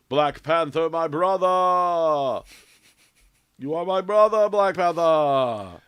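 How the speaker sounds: hammed-up, booming African accent, high and drawn out; Storm's African accent. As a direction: bellow it in a mock African accent, stretching the last words. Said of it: "hopefully she has the cool accent".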